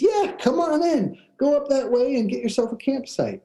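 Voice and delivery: high-pitched, breezy imitation of a guard; park guard. Guard letting campers into the park, imitated guard voice. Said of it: "And the guard says".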